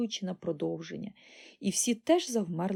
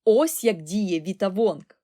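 loud, slow boast; proud; 8 dB louder, slower than usual. A narrator's reading. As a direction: announce it proudly. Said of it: "гордо мовив містер Вонка".

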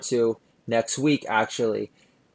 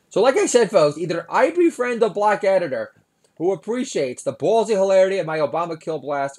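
excited rant; 6 semitones higher, 5 dB louder than usual. Real, not acted. Comic rant to the audience.

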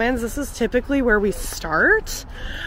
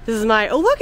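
ordinary talk

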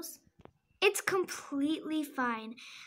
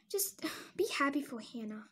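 whispered, much softer, with a sigh; 4 semitones lower, 8 dB quieter than usual. Acted. Friend whispering to Lizzie to be happy for Hannah, whispered. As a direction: hush her down gently.

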